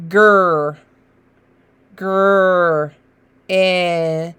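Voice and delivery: "Gur" twice then "In" apart; separate sounds. Shown as a demonstration. Teacher sounding out letters. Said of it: "G and R".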